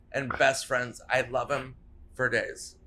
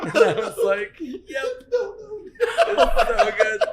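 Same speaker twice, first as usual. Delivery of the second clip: laughing; 12 semitones higher, 4 dB louder than usual. Real, not acted. Laughing at the punchline.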